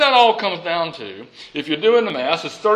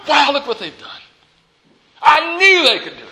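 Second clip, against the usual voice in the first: Jesus speaking; 8 semitones higher, 4 dB louder than usual. Acted. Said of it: "he said".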